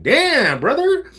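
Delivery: high, loud "Damn, brother" in mock disbelief; shocked reaction. Streamer reacting to a viewer's rant, mock disbelief. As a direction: react like you can't believe what you read. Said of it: "I'm like".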